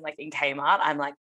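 ordinary talk

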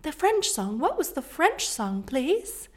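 high, slow, sweet insistence; overly polite mom. Retold or imitated. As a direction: ask again, sweetly, slowly. Mom asks boys again.

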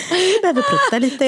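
high-pitched, badly imitated Finnish-accented Swedish; attempted Finnish accent. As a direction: mimic a nurse's Finnish accent, imperfectly. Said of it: "Jag kan inte ens härma hennes dialekt". (halvdant härmad finsk brytning)

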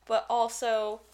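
ordinary talk, nothing marked

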